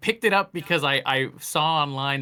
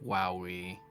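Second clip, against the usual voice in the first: sassy wowee; 8 semitones lower, 11 dB quieter than usual. Retold or imitated. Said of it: "you'll be like".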